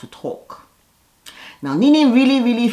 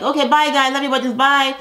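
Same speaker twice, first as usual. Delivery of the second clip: higher sing-song bye to everyone; cheery goodbye; 8 semitones higher, faster than usual. Retold or imitated. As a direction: wave them off brightly. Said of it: "And she's like".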